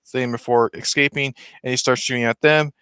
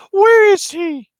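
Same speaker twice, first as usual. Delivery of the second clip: put-on very high, loud girlish squeak; girl's voice; 6 dB louder, slower than usual. Kid corrects himself, counselor in a very high girl's voice. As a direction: squeak it like a small girl. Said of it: "like, a girl's voice".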